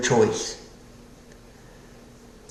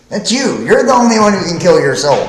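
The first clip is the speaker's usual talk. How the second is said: higher, louder and fast, pointing at you; emphatic preaching; 6 semitones higher, 5 dB louder, faster than usual. Real, not acted. Preacher pressing the point on his listener, higher and louder. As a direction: jab the truth at the listener.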